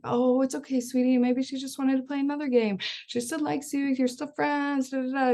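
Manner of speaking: lightly imitating a mom quick-fixing; soothing mom. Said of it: "We're like".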